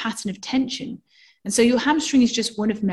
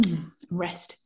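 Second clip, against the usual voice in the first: soft, unvoiced and rest; softly released; 3 semitones lower, 8 dB quieter than usual. Shown as a demonstration. Teacher shows the release phase, soft and airy. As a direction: let the words fade into release. Demonstrating release after a hum.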